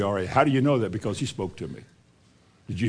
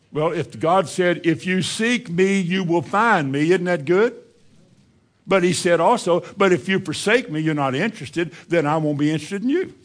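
preaching with force; 5 semitones higher than usual. Acted. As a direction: warn the congregation that God answers in kind.

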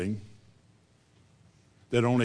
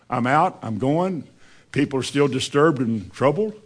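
ordinary delivery

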